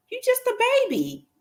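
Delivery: high and fast, incredulous; high, quick disbelieving scolding; exasperated adult. Retold or imitated. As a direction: scold a know-it-all kid in disbelief. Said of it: "and you're like".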